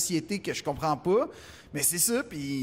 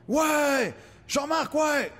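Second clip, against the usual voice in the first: excited roaring crowd; crowd cheering; 8 semitones higher, much slower than usual. Retold or imitated. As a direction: cheer like a packed hall. Crowd erupts for Jean-Marc.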